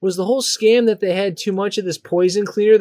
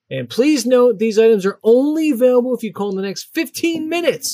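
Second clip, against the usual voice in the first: upbeat pitchman voice, higher; infomercial; 6 semitones higher than usual. Pretend. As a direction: sell it like a TV ad announcer. Reads the ad like an announcer.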